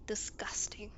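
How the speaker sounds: breathy unvoiced whisper of disgust; whispered with a sigh. Real, not acted. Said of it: "You know, very".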